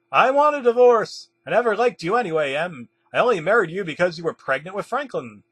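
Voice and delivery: angry outburst. A narrator's reading. Ainsley tells the spouse they want a divorce, angry outburst.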